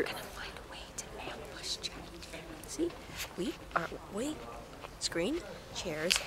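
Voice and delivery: conspiratorial whisper. Real, not acted. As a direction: plot it in a hushed whisper. Sneaking near front at premiere.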